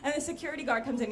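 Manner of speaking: normal talking voice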